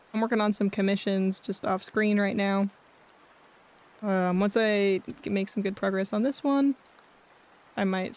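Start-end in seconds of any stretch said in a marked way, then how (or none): none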